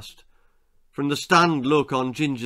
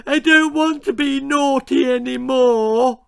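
put-on sobbing little girl; 11 semitones higher, 6 dB louder than usual. A narrator's reading. Scared little Lizzie begging to stop, sobbing. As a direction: plead through tears. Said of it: "she sobbed".

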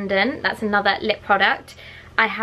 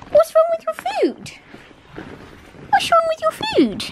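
coaxing pet talk; 12 semitones higher than usual. Real, not acted. Owner coaxing her dog to eat, high baby talk. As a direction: coo and coax in short, high questions.

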